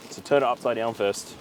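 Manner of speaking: usual speaking voice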